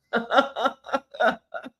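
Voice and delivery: bright burst of real laughter; high laugh. Real, not acted. Laughing at the viewer's double account, real laugh.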